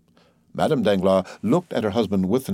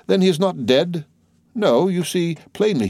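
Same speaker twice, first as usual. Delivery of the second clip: high, pressing question; a servant reeling at the sight of the man he killed; shocked disbelief; 9 semitones higher than usual. A narrator's reading. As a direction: cry it out in horror. Shock at the living victim.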